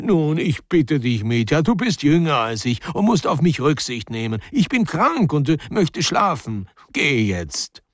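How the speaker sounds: whiny pleading. A narrator's reading. Older man begging Mitja to leave him the arbour, whiny. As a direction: plead in a put-on whine, stretching the words. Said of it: "sprach mit weinerlicher Stimme".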